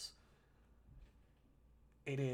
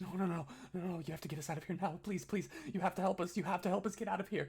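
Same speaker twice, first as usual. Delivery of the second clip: high, fast, panicked begging; frantic pleading; 6 semitones higher, much faster than usual. Acted. Captive cutting off Kashak to beg escape, frantic pleading. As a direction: rush the words out, begging not to be left.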